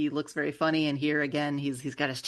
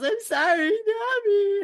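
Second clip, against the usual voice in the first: high, louder sobbing apology; crying yell; 6 dB louder, slower than usual. Retold or imitated. Shark Zeus apologizes to Nami while crying, in a high sobbing yell. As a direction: wail it through tears. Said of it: "And Zeus yells".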